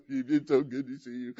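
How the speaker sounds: hammed-up weeping with laughing sobs; mock happy crying. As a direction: imitate blubbering at a joyful reunion. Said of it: "they're just crying on each other".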